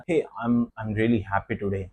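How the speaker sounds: low, soft and slow, no joy in it; flat joyless read; flat unhappy tone. Shown as a demonstration. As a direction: say it like you don't mean it. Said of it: "मेरी intonation गलत थी".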